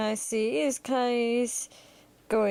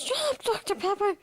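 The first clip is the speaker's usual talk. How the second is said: quick, high-pitched dismissive voice; high, rapid character retort; Great One; 10 semitones higher, faster than usual. Acted. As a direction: silence them fast, pitched way up. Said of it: "He goes".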